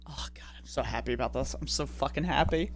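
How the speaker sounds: near-whispered, low and soft; hushed delight. Real, not acted. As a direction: murmur it to yourself, overjoyed. Overjoyed, talking low to himself.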